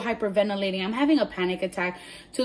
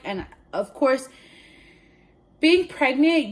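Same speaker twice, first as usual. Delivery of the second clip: ordinary delivery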